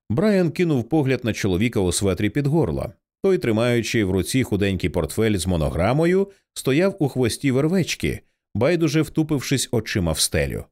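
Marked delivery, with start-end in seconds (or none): none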